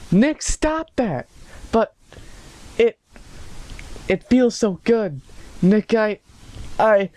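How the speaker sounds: high-pitched, halting protest; mock girlfriend voice. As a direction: put on a high, hesitant lover's voice. Voices female narrator of fanfic.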